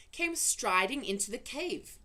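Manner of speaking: ordinary delivery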